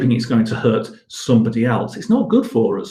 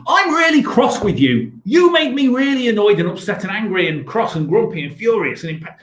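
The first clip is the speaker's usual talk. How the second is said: higher, louder mock complaint, piling up words; a teacher hamming up a grumpy complaint; mock cross telling-off; 7 semitones higher, 3 dB louder than usual. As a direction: rattle off the grievances like a sulky child. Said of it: "going to that person and saying".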